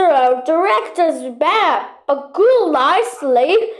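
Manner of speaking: ordinary talk, nothing marked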